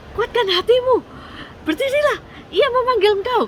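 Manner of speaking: loud, very high acted shout; rally someone to get up; excited crowd call, pitched high; urgent calling out. A narrator's reading. Crowd calls the blind man over.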